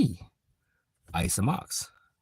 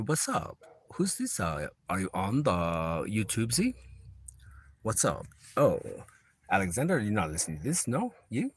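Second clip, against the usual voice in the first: ordinary talk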